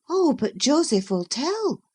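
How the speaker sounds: put-on high girl's voice, a coaxing warning; suggesting Catherine. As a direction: pitch it up and coax him away. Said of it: "she suggested".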